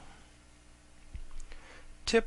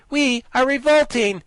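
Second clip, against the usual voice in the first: high, loud proud declaration; fierce girl general; 13 semitones higher, 10 dB louder than usual. Acted. Ginger declares the revolt to the guardian, high and fierce. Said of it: "exclaimed the general fiercely".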